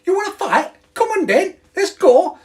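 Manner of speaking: very high, loud Scouse caricature; Scouse accent. Shown as a demonstration. Streamer shows off his Scouse to viewers, loud high Scouse accent. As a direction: pick a fight in a shrieky, hammed-up Scouse accent. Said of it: "In this Scouse, I go".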